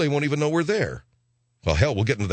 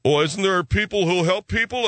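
mock whiny; 6 semitones higher than usual. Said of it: "isn't that the attitude of most of us".

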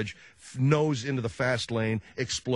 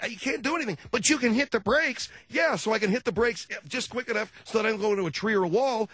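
mock heated argument; 9 semitones higher than usual.

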